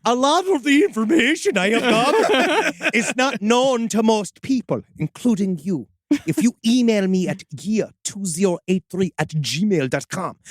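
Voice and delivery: sing-song mock Swedish chef. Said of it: "Do Swedish Chef".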